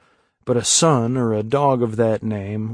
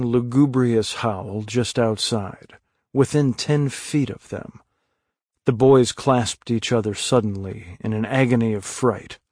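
ordinary delivery

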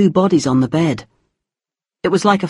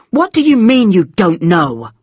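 angry; 3 semitones higher, 4 dB louder than usual. A narrator's reading. Angry mother pressing.

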